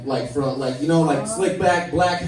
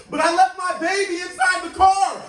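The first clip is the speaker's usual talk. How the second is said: greaser whining; 14 semitones higher, 3 dB louder than usual. Retold or imitated. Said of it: "He was like".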